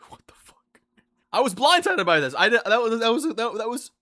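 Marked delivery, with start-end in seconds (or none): 0.1-0.8 breathless stunned whisper; whispered what the fuck. Retold or imitated. Speaker stunned as coworker walks away, whispering. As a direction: whisper it, blindsided. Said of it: "I'm like".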